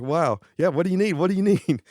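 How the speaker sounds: high-pitched eager scramble; suddenly keen, pitch climbing with each question; flustered eagerness. Retold or imitated. Guitarist realizing the star is real, flustered and eager.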